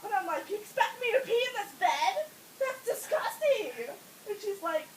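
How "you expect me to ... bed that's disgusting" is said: high-pitched mock outrage; appalled, whiny retort; indignant protest. Acts out indignant protest to nurse.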